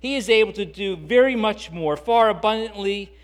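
loud, building preacher's cadence; rising fervent sermon build to the peak; preaching at full force. Acted. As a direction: drive each clause home harder.

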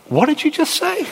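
hammed-up shock; mock disbelief. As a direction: react stunned and offended. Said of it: "you'd go".

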